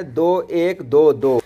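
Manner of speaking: दो एक दो दो spaced out slowly, falling at the end; slow meter count. Shown as a demonstration. Teacher slowly chanting the meter of जिंदगानी. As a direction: count दो एक दो दो like marking beats. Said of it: "तो जिंदगानी में क्या आता है?".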